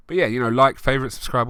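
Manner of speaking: normal talking voice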